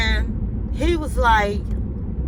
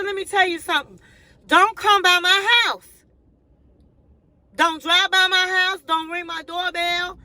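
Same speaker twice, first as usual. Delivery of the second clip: high, raised scolding voice; angry warning; 7 semitones higher than usual. Retold or imitated. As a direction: tell the pushy suitor firmly to stay away. Telling off a stalker.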